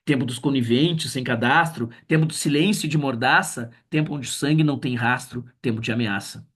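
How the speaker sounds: measured, solemn poem reading; poem recital. Acted. Reads poem aloud. (recitação solene de poema)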